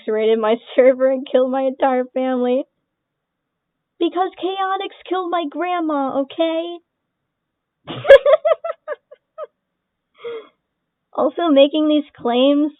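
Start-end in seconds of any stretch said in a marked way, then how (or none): 4.0-6.8 mock sarcastic claim. Mocking the accusation. 7.9-8.9 sudden squeaky laugh; high shrill laugh. Real, not acted. Laughs at own joke.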